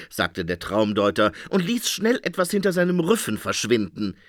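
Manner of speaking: ordinary delivery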